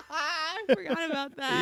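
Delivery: high laughing memory. Real, not acted. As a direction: talk through laughter.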